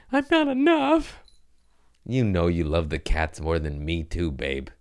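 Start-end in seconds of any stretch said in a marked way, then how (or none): 0.1-1.2 squeaky high mock whine; mock Sadie voice. Mimicking his girlfriend Sadie, squeaky mock whine. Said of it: "Send it to Sadie".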